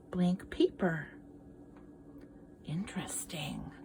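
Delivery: hushed whisper over the picture; whispered aside. Real, not acted. Teacher whispering to children about the picture.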